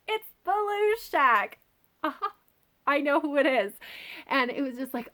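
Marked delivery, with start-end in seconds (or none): 0.1-3.7 gleeful knowing reveal. Retold or imitated. Fan telling herself she guessed the villain, gleeful and high. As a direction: crow that you knew it all along. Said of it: "And I'm like".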